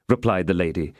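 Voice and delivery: plain reading voice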